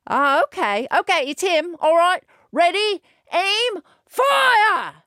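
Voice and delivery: a guard barking the order to fire; guard spots Hans and orders the archers to fire, shouting; guard giving orders. Acted. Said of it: "the guard said".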